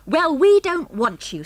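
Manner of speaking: loud, high, fierce retort. A narrator's reading. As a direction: turn on him fiercely. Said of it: "said Harry fiercely".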